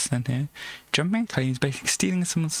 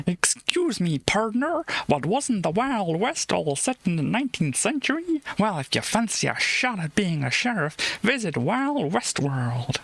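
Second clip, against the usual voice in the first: hammed-up Wild West cowboy accent, pitched higher; terrible cowboy accent; 5 semitones higher than usual. Reading the Wild West World ad aloud in a bad cowboy accent. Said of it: "I know that was a terrible accent".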